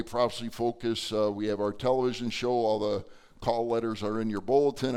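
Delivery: ordinary talk, nothing marked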